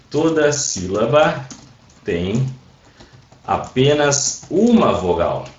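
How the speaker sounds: slow, with pauses round "tem"; emphatic rule. Real, not acted. Teacher stressing one vowel per syllable, slowly and emphatically.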